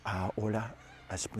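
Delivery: very soft, low murmur into an ear; hushed imitation of a secretive translator; whispering. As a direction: whisper made-up words confidentially. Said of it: "so she whispers in his ear".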